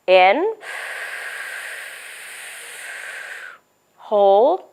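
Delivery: slow, calm breathing count; guided breathing. Shown as a demonstration. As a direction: lead kids through breathing. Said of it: "all of you are my kids in my office".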